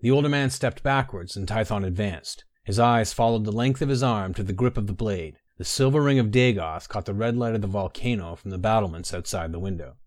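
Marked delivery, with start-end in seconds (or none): none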